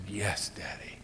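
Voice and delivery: breathless little whisper of yes; a daughter whispering yes to her father. Retold or imitated. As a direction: whisper it, overwhelmed. Daughter answers ring question softly.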